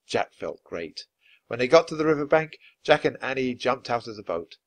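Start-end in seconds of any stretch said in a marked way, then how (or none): none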